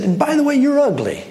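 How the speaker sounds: high-pitched acted insult; acting out a mean heckler. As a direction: hurl a mock insult, pitched well up. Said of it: "just as mean as you can imagine".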